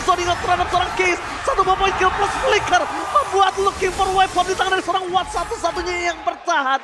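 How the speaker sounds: loud, excited game casting; live play-by-play. Real, not acted. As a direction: call every kill with energy.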